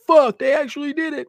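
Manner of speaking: a stunned ex-boss blurting it out; imitating a stunned exec. Imitated comics exec reacting.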